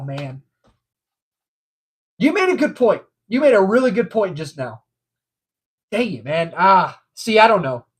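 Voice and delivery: ordinary talk, nothing marked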